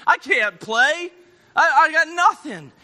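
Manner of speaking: drawn-out, acted whine of defeat; exhausted kid's complaint. Tired kid turning down a game of ball, whining. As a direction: stretch it out, rising into exasperation. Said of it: "in his heart, he's going".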